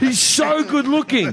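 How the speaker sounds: high, drawn-out gush of admiration. Real, not acted. Comic raving to a friend about her handsome husband, gushing.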